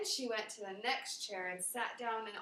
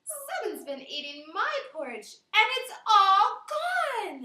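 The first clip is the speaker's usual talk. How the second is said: put-on Baby Bear building to wail; 10 semitones higher, 11 dB louder than usual. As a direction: start small, then cry out it's all gone. Baby Bear voiced in story.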